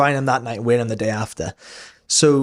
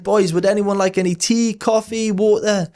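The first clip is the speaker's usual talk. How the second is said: cheerful coworker; 7 semitones higher than usual. Retold or imitated. Voicing cheerful coworker offering drinks.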